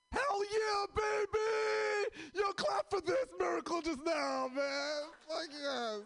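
high, loud crowd-hyping yell; hyped-up shout. Acted.

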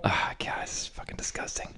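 under-the-breath disgusted whisper; whispered aside. Real, not acted. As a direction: turn away and whisper it to yourself. Muttered aside at cat fans.

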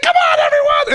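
very high shouted cheer; screeching cheer. Retold or imitated. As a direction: shriek it like the section captain. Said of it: "She's like".

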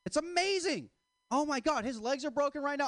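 exaggerated, high-pitched thrill; hammed-up glee. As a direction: gush over it in a high-pitched voice. Gleeful boast about father's helplessness.

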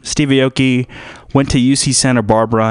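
normal talking voice

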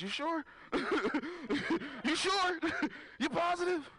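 high, laughing "you sure?"; mock disbelief. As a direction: push it up in fake disbelief. Mocking buddy's tattoo.

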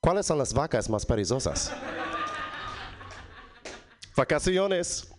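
Texas accent, joke told in Spanish; authentic Texas accent. Shown as a demonstration. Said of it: "a joke that only works in a Texas accent".

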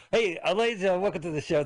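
big loud show-host welcome; announcer voice. Acted. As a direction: open the show like an emcee.

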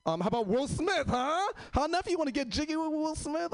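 high, eager salesman voice; hammed-up record store clerk pushing a sale; mock pushy clerk.

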